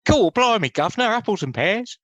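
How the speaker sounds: very high, hammed-up mock Cockney squawk; bad Cockney. Imagined actor auditioning for a London role, bad Cockney. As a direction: do a dreadful, over-the-top Cockney accent. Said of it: "Can you do a London accent?".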